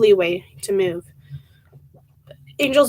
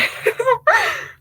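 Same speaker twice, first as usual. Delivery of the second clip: a high, cracking laugh near tears; half laugh, half sob, really welling up; real teary laugh-sob. Real, not acted. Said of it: "I'm about to cry".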